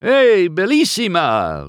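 put-on high, loud Italian-accented exclamation; excited Italian cry. A narrator's reading. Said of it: "Luigi cried".

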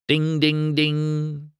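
high, sustained bell imitation; ringing gas-pump dings; bell dinging. A narrator's reading. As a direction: chime it on one high note.

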